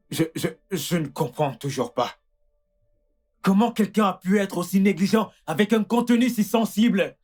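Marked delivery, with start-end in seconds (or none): 3.4-7.2 raised, indignant reproach. Acted. Husband to doctor about negligence, indignant. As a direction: demand an answer from the one to blame.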